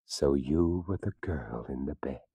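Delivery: low, hushed, trembling with dread. Acted. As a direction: shaken whisper of a realization. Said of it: "spoke in a trembling voice".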